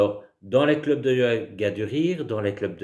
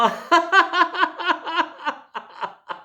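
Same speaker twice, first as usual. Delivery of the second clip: long high-pitched laughter; 5 dB louder than usual. Shown as a demonstration. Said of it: "voyons les choses différemment". (long éclat de rire aigu)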